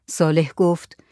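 plain reading voice

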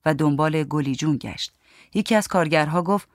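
plain reading voice

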